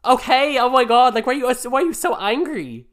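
high, loud exasperated protest; loud, high-pitched squabbling; exasperated outburst. Real, not acted. Mock quarrel with co-host.

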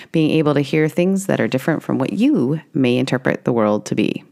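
loud, clear announcement read; disclaimer read. Acted.

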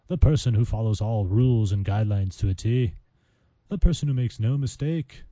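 hammed-up British accent, lifted in pitch; mock British accent. Podcaster playing a decorous citizen for listeners, mock British accent. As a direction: put on an exaggerated proper British voice. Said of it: "any of my British li-, uh, listeners out there".

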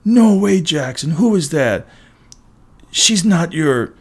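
high, loud mocking disbelief; taunting schoolboy. A narrator's reading. Bully gawking at snatched photo, teasing.

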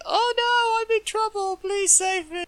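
mock damsel in distress. Mocking the wife begging to be saved, high damsel voice. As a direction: plead for rescue like a cartoon damsel. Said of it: "she's like".

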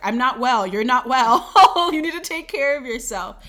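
loud, high-pitched body complaining; hammed-up cry for attention, breaking into a laugh; mock urgent plea. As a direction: play an exasperated body pleading to be heard. Voices the body pleading for care.

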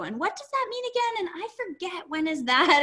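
high, puzzled questioning; mock confusion. Astrologer mimicking someone lost in chart details, mock confused. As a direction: play someone losing track, pitched well up. Acting out a baffled astrology client.

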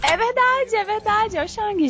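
high excited agreement. Real, not acted. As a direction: bounce up with glee.

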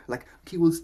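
ordinary delivery

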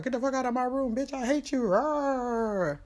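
high-pitched imitation of an angry son; mock teen tantrum. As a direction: throw a hammed-up tantrum ending in a growl. Son yelling at mom, retold.

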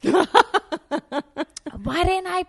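high, loud, laughing mock Roseanne; imitating Roseanne, loud and amused; brash laughing star. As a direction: roar it with delighted disbelief.

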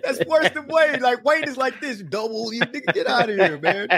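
amused outrage at the nickname; laughing protest. Real, not acted.

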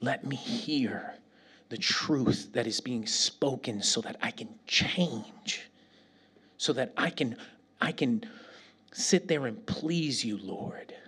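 slow, soft, humble plea fading to a whisper; humble prayer. Pretend. Preacher voicing a humble prayer to the Lord. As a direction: pray it gently, surrendering. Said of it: "instead of saying".

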